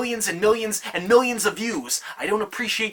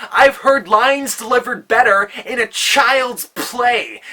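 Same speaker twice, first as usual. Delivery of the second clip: put-on "child's play" punched louder, higher and slower; loud pun payoff; 5 semitones higher, 6 dB louder, slower than usual. Shown as a demonstration. Landing the pun on the rival poet, loud and emphatic. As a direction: hit the punchline hard and high. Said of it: "is child's play".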